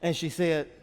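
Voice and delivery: normal talking voice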